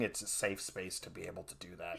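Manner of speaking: soft mumble. Real, not acted. Self-deprecating aside.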